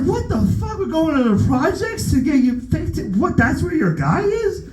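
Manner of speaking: very high, shrill incredulous questions; outraged disbelief. Retold or imitated. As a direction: squeal it up high in mock outrage. Going to projects for teeth.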